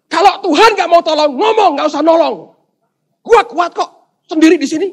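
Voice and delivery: high, loud defiant shouting; angry defiance. Acted. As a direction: refuse help at full voice. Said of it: "Dengan hati marah dengan Allah".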